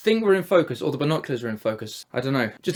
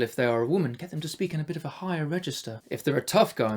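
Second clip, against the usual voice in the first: ordinary delivery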